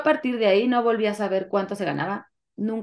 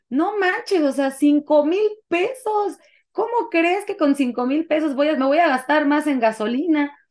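raised, higher-pitched voice of indignant griping; indignant complaint; 6 semitones higher, 3 dB louder than usual. Retold or imitated. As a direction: retell a loud, incredulous complaint. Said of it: "levanté la voz, pero como quejándome".

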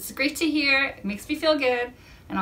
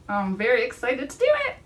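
ordinary delivery

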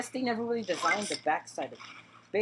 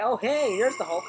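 sudden louder, higher exclamation; surprised greeting; 4 semitones higher, 5 dB louder than usual. Real, not acted. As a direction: break off and call out on spotting someone. Suddenly spots the boss, cuts himself off.

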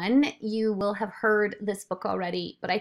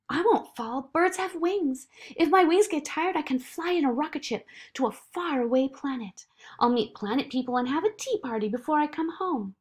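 put-on higher, eager child's voice; Zoe, confident child; 7 semitones higher, 3 dB louder than usual. Zoe answering the doubting voice, eager and sure. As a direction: brush off the doubt and run on excitedly.

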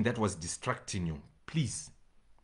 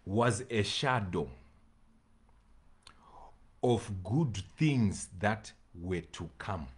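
normal talking voice

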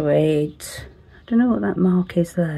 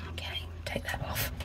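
whispering; 19 dB quieter than usual. Real, not acted. Whispered aside.

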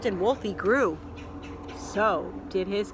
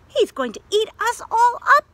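high, loud frightened shout; screamed; 13 semitones higher, 7 dB louder than usual. Acted. Dot's friends screaming in fright at the wolf. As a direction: scream it like scared kids. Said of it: "they screamed".

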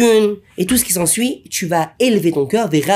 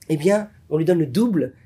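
ordinary delivery

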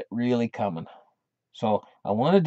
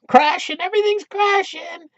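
hammed-up, much higher, louder squeal; mock gloating economists yelling; 4 dB louder than usual. Economists jumping up and down, hammed-up high gloating yell. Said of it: "jump up and down a few times and yell".

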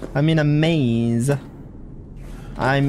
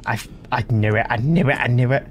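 usual speaking voice

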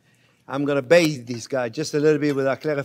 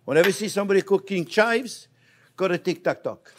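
usual speaking voice

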